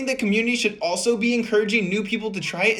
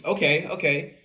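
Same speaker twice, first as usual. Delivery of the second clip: ordinary talk, nothing marked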